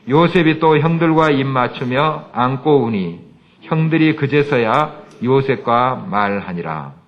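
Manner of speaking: loud, slow congregational reading; scripture reading. Acted. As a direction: read it out together, slow and strong. Reads scripture aloud together.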